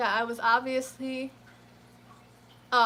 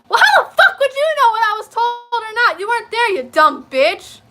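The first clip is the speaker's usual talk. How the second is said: furious shout; 10 semitones higher, 14 dB louder than usual. Retold or imitated. Retelling screaming at the clerk at work, furious shout. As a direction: scream it at her, losing it. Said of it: "I snapped".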